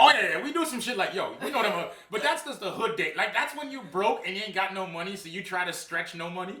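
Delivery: high, loud, fast excitement; excited. Real, not acted. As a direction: get hyped about it.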